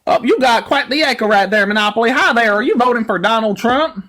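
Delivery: high, twangy country emcee put-on; exaggerated Southern yokel host; hillbilly cattle caller. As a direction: holler like a rancher herding cattle. Mock cowboy host persona.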